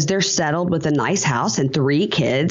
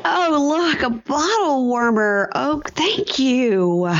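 high, slow sugary thank-you; mock gushing thanks; 9 semitones higher, much slower than usual. Friend mocking a guest's fake thanks, high and slow. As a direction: gush over the gift in a put-on sweet voice. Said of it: "You're like".

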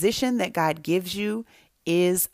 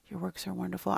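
whispered; 11 dB quieter, much faster than usual. Retold or imitated. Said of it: "He was whispering it in the beginning".

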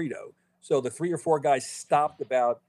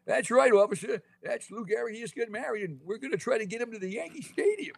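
put-on much higher old cowboy voice; Walter Brennan impression; 10 semitones higher than usual. Mimicking the movie for podcast hosts, high old-timer voice. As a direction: squeak it out like a creaky old sidekick. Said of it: "Walter Brennan going".

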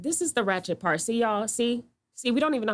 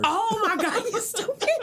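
laughing, high; 8 semitones higher than usual. Real, not acted. Teasing a friend over a joke, laughing. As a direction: crack up while teasing a friend.

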